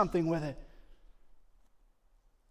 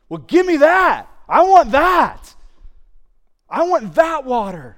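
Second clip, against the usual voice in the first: much higher, loud, stretched-out longing; eager plea; 12 semitones higher, 17 dB louder, slower than usual. Acted. Samaritan woman begging Jesus for water, much higher and loud. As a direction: cry out for it, much higher and louder.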